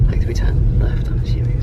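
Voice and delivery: hushed whisper. Real, not acted. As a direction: whisper so nobody hears. Sneaking, unsure of way.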